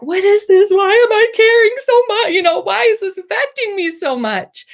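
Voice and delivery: high, loud, incredulous outburst easing off in volume; bewildered self-questioning. Retold or imitated. Asking herself why she cares so much, bewildered and high.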